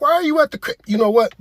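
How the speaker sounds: high-pitched, incredulous question cut short; alarmed dad. Acted. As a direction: blurt it in alarm, voice shooting up. Father alarmed at son's location.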